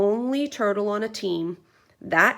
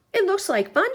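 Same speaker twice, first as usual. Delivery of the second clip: high deer voice; 12 semitones higher, 6 dB louder than usual. A narrator's reading.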